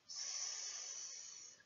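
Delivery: a long unvoiced sss alone; hissed s sound. Shown as a demonstration. Teacher isolates the sound heard in os, long hissed s. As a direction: hold the s as a soft, steady hiss. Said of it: "puisqu'on entend le son".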